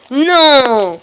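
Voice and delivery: loud, high wail falling away; drawn-out gamer's yell; long dismayed cry. Real, not acted. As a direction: wail it as you fail. Fails in the game.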